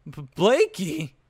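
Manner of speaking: slow, loud, high stammered cry; flustered protest. A narrator's reading. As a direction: stammer it out, embarrassed.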